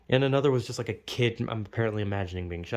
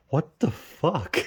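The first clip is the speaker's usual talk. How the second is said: drawn-out, higher-pitched "what on earth" reaction; retold disbelief; 4 semitones higher, much slower than usual. Dreamer recalls waking up shocked, retold disbelief. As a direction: stretch it out in stunned disbelief. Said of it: "I was just like".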